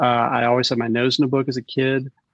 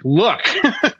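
high, stammering self-defence; pitched-up, half-laughing protest; mock exasperation; 9 semitones higher, slower than usual. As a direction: imitate a flustered parent pleading with a critical kid. Acted exasperated reply to son.

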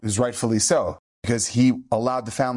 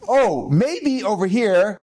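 gasp, then a higher, slowed mock discovery; mock surprise; 6 semitones higher, 3 dB louder, much slower than usual. Rabbi pretends to find a spot on the boy: hammed-up gasp of discovery. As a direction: pretend you just spotted something.

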